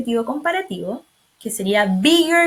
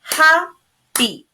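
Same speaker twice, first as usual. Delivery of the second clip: Happy broken into HAP-PY, louder and clearly beat by beat; syllables counted out; 4 semitones higher, 5 dB louder than usual. Shown as a demonstration. Teacher counting syllables of Happy for the class. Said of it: "Let's count".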